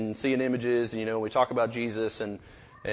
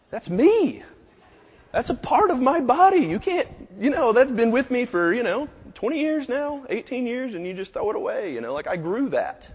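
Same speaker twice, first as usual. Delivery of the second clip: high-pitched, hammed-up outrage; comically wounded objection; indignant protest; 11 semitones higher, 3 dB louder than usual. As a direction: plead for a discarded piece of yourself. Protests his fingertip being binned.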